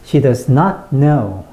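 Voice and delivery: slow, light "She does", strong "not know"; stress NOT KNOW. Shown as a demonstration. Teacher demonstrates to learners, heavy stress on "not know". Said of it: "요렇게".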